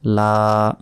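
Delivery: লা with a long held aa; stretched vowel. Shown as a demonstration. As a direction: hold লা for a beat. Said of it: "লাতে এক second টান হবে".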